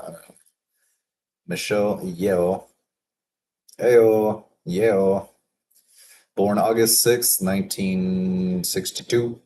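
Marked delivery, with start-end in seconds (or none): 1.5-5.3 feeling out the sound of "Yeoh"; trying out pronunciations. Real, not acted. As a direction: sound out "Yeoh" uncertainly, varying it each time. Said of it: "How would you pronounce that?".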